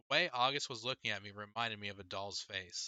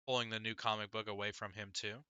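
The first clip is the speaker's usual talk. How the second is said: plain reading voice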